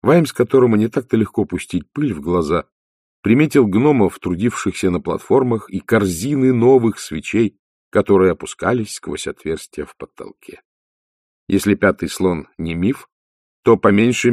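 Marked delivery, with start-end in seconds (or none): none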